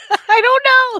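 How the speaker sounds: high, loud, laughing protest; laughing helplessly. Real, not acted. Guest put on the spot for a zombie impression, laughing. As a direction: blurt it out through a burst of laughter.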